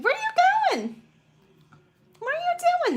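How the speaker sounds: high, sing-song voice to the kittens; pet talk. Real, not acted. Talking to the kittens.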